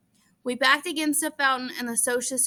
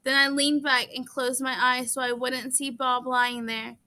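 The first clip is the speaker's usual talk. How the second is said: plain reading voice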